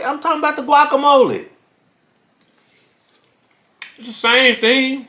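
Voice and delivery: high, exasperated insisting. Retold or imitated. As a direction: insist it's obvious. Retold own exasperated reply.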